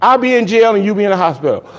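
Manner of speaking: mock threat. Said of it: "I said".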